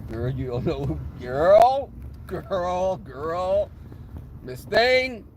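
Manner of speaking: sassy queen calling someone out; inner queen. Acted. Inner queen scolding a coworker, sassy. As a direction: draw out each "girl" with attitude. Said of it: "the inner queen would come out".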